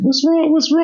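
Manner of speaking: very high, urgent repeated question; frantic grandma. Retold or imitated. Grandma rushing in to the commotion, frantic. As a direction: fret like a worried grandmother. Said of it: "Grandma was like".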